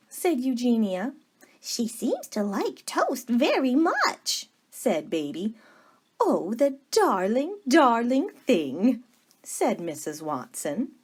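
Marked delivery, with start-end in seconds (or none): 1.6-4.5 higher, eager voice of a kind younger sister; sweet Baby Lincoln. Acted. 6.2-9.0 higher, doting coo, slowing on the second darling; cooing over the piglet. Acted. Missus Watson coos.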